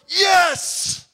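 sudden high, loud yell of victory; a fan erupting at the winning goal; triumphant cheer. Retold or imitated. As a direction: shout it like your team just scored. Cheering a score in a tight game.

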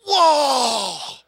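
loud startled shout at a wound; alarmed whoa. Acted. Bystander gaping at a wound, shouting in alarm. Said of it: "You don't look at him and say".

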